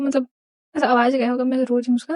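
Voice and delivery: ordinary talk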